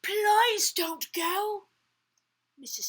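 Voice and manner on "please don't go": high, loud, slow plea; pleading storytime wail; children crying out. A narrator's reading. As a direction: beg loudly, dragging each word. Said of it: "The children cried out".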